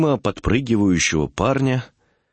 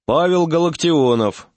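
higher-pitched summons, mildly raised voice; calling out; 7 semitones higher than usual. A narrator's reading. Doctor summoning the next patient by name, calling out. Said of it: "крикнул доктор".

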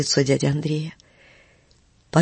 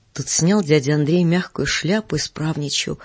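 usual speaking voice

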